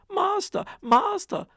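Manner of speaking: very high, loud alarm cry; the harp raises the alarm again. Acted. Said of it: "the harp cried out".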